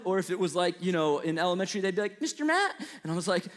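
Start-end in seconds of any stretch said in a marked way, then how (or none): 2.2-2.7 put-on high little-kid voice; lightly voiced young pupil asking; imitating a child. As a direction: pipe it up like a curious student. Kids questioning the teacher.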